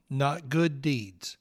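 ordinary talk, nothing marked

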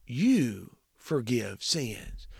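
YOU forgive sins, slow, weight on YOU; emphatic YOU, slow and soft; stress on you. Shown as a demonstration. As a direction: press on YOU, unhurried. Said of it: "the you is emphatic".

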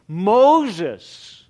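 loud call across distance. Acted. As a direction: call his name out loud. God calling out from bush.

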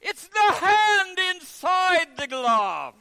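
high, loud, slow mocking taunt; scornful kid calling out; cheeky English boy. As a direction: play the know-it-all schoolboy. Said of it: "one of the boys ... said".

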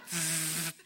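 buzzing "zzz" sound effect; imitated descending cable. Preacher mimics a movie-style lowering, buzzing noise. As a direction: buzz like a line being let down.

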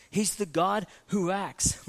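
normal talking voice

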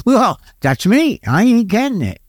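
retold eager self-recognition; perking up. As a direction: perk up suddenly, pitch jumping. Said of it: "And I'd perk up".

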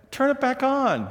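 a playful pushy order. Retold or imitated. Son orders his father to turn the news back on, mock demanding.

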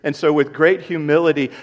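ordinary delivery

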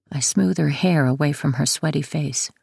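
usual speaking voice

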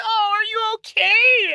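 very high, drawn-out cooing falsetto; mock fussing wife. Husband imitates wife rushing over as he cried, mock gushing falsetto. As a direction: gush slowly in exaggerated, squeaky sympathy. Husband mimics wife's concern again.

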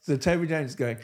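normal talking voice

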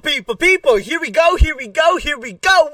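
high, loud hype chant; hype intro. Acted. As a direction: pump up the crowd.

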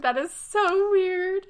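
high, slow, drawn-out disbelief; incredulous. Retold or imitated. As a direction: stretch it out in disbelief. Outraged reaction quoted.

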